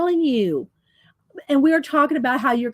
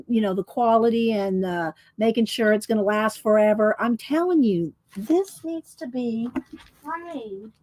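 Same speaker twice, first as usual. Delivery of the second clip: normal talking voice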